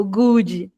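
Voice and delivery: "good" said as "gudji"; Brazilian extra i. Shown as a demonstration. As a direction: tack on the imaginary i. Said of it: "coloca um i imaginário". ("good" com i no final)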